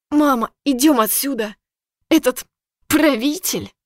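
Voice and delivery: mocking. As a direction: sneer at the ruler. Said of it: "В её голосе послышалась насмешка".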